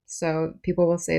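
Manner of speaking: normal talking voice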